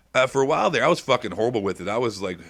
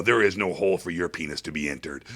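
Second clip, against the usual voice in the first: ordinary delivery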